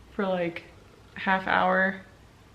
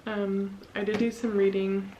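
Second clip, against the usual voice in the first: ordinary delivery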